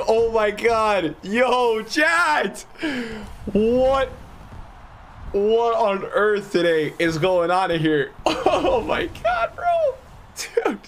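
high, slow awed laughing; excited disbelief. Real, not acted. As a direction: laugh out of pure shock. Still reeling from the Zidane pull.

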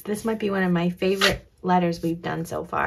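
ordinary delivery

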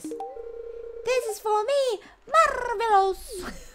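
high, drawn-out hammed-up Italian accent; slow, squeaky-high mock Italian voice. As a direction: play it like a Mario-style Italian, way up high. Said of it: "I don't know why I do the Italian accent".

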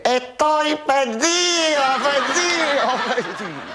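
high, slow wailing lament; hammed-up lament. Lost in the jungle night, high slow wailing then fading. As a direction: wail it out, fading to nothing. Comic punchline cry.

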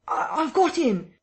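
high, loud stammered scream; frantic triumph while grappling. Acted. Kemp screams to the crowd while grappling the unseen man. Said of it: "screamed Kemp".

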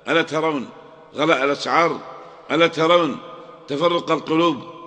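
normal talking voice

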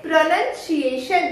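"प्रोनाउंसिएशन" said pruh-nun-si-ation; correct pronunciation. Shown as a demonstration. Teacher answering her own question, correct pronunciation. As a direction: use "nun", not "noun", in "प्रोनाउंसिएशन". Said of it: "क्या बोलते हैं".

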